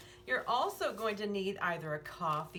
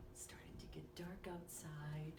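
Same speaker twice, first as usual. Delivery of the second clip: hushed whisper, softer; 2 semitones lower, 14 dB quieter than usual. Acted. Telling the child it's getting dark, whispered. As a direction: whisper it as the room darkens. Whispered aside in bedtime story.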